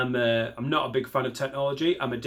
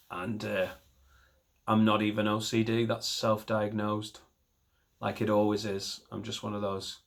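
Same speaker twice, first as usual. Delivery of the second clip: usual speaking voice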